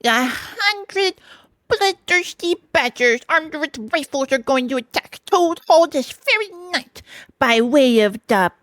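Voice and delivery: high, slow, ominous put-on voice; indignant washerwoman. A narrator's reading. Mole in disguise bluffing the stoats, emphatic. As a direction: spell out the threat with relish.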